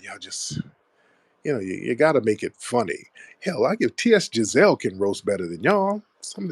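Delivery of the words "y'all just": exasperated whisper. Real, not acted. Host sighing at trolling callers, whispered. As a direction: drop to a breath, fed up.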